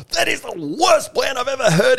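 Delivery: very high, loud furious yell; accent dropped, outraged. Acted. Instructor tearing into a recruit's plan, outraged yell. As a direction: explode at the recruit, acting it out in full. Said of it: "the accent just disappears".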